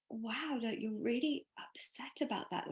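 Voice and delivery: soft, half-whispered realisation; hushed self-talk. Retold or imitated. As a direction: think it aloud under your breath. Acting out her own inner thought.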